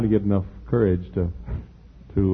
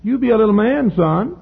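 high-pitched mock motherly urging; put-on high mama voice, getting louder; imitating his mother; 13 semitones higher, 5 dB louder than usual. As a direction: coax a small boy as his mama. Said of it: "how you used to say to me".

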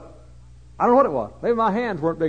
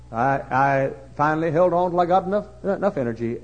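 ordinary talk, nothing marked

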